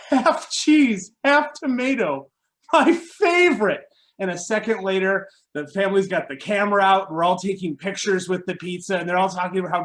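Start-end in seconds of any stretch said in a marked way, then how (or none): 0.1-3.8 imitating a thrilled mom, high and loud; delighted mom. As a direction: gush slowly in a high, touched voice. Mom delighted by surprise pizza.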